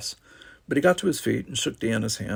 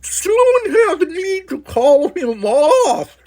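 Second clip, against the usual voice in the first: very high, slow alien sarcasm; sarcastic alien; 10 dB louder, much slower than usual. A narrator's reading. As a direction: squeak it out high and dry. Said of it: "said sarcastically".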